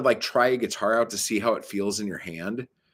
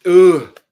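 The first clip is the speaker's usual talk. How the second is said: loud, high frustrated "ugh"; exasperated groan; 6 semitones higher, 14 dB louder than usual. Real, not acted. Fed up with show-off store clerks, exasperated groan. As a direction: let out a loud groan of disgust. Frustrated noise after rant.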